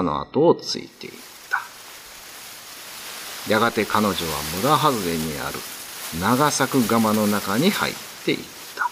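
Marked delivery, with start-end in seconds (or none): none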